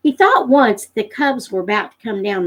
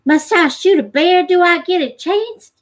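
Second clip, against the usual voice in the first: put-on Jody's higher, eager boy voice; eager boy's question; 8 semitones higher, 3 dB louder than usual. Young Jody eagerly asks Pa about shooting a bear. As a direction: ask it like a hopeful young boy. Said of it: "Jody asked".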